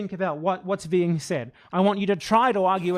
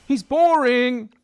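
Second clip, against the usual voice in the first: very slow and louder, dragging out boring; mock bored drawl; 5 semitones higher, 6 dB louder, much slower than usual. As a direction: stretch it out to mock the complaint. Repeats the critic's complaint twice.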